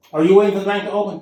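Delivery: fast, loud imitation of a stern bank lady; mock her bossy challenge; authoritative lady. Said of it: "in a very authoritative voice".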